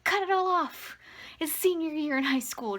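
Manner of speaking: high, loud burst of reckless enthusiasm; re-enacted excited outburst; gleeful mock yell. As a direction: cry it out like an impulsive teen decision. Acts out past impulsive decision.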